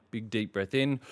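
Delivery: usual speaking voice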